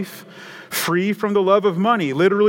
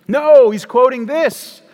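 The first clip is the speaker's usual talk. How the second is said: much higher and louder, slow; emphatic no; 9 semitones higher, 10 dB louder, slower than usual. Real, not acted. Preacher correcting the congregation's assumption, loud and emphatic. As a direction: slam the correction down hard. Rebutting the imagined hymn writer.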